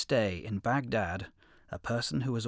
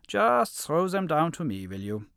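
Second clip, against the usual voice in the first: wolf voice; 5 semitones higher, 6 dB louder than usual. A narrator's reading. Wolf orders the lion.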